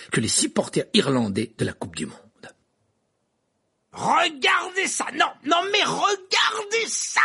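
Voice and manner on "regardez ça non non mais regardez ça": high, slow excited yell; commentator shout. A narrator's reading. Verpé yelling to the crowd, excited shout. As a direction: shout it to the whole stadium. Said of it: "hurlait Verpé".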